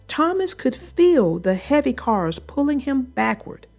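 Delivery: usual speaking voice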